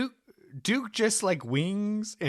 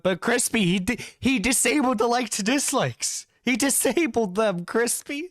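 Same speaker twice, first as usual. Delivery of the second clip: indignant; 6 semitones higher than usual. Real, not acted. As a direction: pitch up in outrage. Indignant outburst at Duke.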